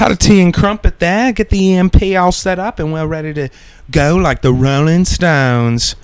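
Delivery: high, hammed-up British accent; bad British accent, stretching the final words; Don Cheadle. Riffing on EMP and tea, in a bad British accent. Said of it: "generic British slang".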